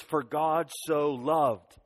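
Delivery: very slow, each word drawn out; slowed quotation. Shown as a demonstration. Pastor slowly quoting the verse opening to kids. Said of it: "that Bible verse begins".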